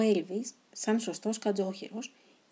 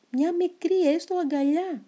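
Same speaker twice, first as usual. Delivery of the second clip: higher, louder, slow pleading cry; desperate shout; 8 semitones higher, 5 dB louder than usual. Acted. Hedgehog shouting to the footballers for a tiny hug. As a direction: stretch out the begging shout. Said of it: "φώναξε".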